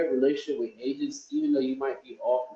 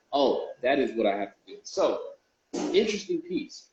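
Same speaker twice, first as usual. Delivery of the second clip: ordinary talk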